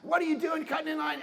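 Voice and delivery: high, loud mock yell at a line-cutter; mock angry yelling. People in line yell at a line-cutter, mock angry yelling. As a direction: bellow it like an outraged crowd. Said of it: "People are just screaming".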